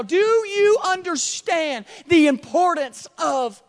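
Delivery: fiery revival preaching; preaching at full force. Acted. Preaching builds to shouting.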